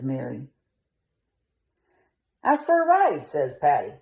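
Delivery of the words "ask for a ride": high dog voice. Acted. Patty Dog voiced in story.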